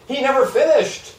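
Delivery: leaps high to stress the word finished; emphatic surprise. Real, not acted. Lecturer stressing to students that the author never finished college, in an emphatic voice. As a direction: hit finished hard to show how remarkable it is.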